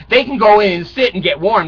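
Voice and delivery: ordinary talk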